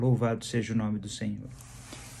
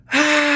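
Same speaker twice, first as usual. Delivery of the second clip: high, loud, sustained "aah" yell; long high-pitched cry, not at full force; restrained demonstration shout; 13 semitones higher, 8 dB louder than usual. Shown as a demonstration. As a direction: show a battle cry, held back a little. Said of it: "Tô gritando baixinho".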